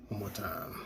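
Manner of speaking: muttered under his breath; whispering. Real, not acted. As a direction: whisper it to yourself. Muttering to himself while working.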